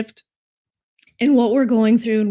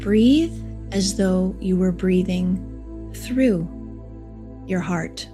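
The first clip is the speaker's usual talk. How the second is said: soft, slow meditation voice; guided meditation; 7 dB quieter than usual. Acted. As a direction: guide them gently into calm, unhurried. Leads a heart meditation.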